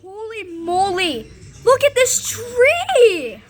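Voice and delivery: high excited yell with a laugh; excited shout. Real, not acted. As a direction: shout in amazement. Trees bending in high winds.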